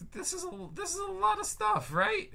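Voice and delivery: high squeaky disbelief; squeaky amazed. Real, not acted. Amazed at script size.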